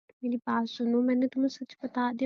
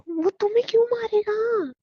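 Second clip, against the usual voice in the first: much higher, loud and startled; alarmed disbelief; 10 semitones higher, 4 dB louder than usual. Acted. Wife horrified he wants Akash to kill him, high alarm. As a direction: recoil in alarm.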